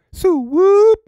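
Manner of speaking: high, loud gang call; Sue whoop call. Pretend. Veteran finally using his call, loud and high. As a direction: belt it out like a street call. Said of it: "I've been meaning to use Sue whoop".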